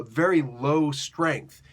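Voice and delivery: ordinary delivery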